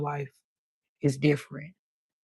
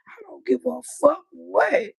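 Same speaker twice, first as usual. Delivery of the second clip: defiant retort; 10 semitones higher than usual. Retold or imitated. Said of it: "so I was like".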